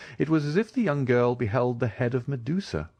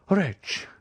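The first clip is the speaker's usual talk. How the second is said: high, sharp cry of fury; rage; 10 semitones higher than usual. Acted. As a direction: spit the word out in anger. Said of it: "her eyes flashing with rage".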